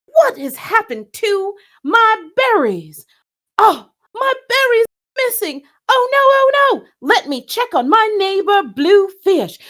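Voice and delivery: hammed-up distressed Redfish; distraught puppet. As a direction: wail about the missing berries. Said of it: "they stop in surprise".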